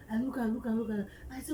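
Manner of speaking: ordinary talk, nothing marked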